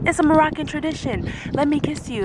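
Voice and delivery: mock yell of the pushy driver; imitating yelling. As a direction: driver pushing hard, half-yelled. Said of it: "And he started yelling".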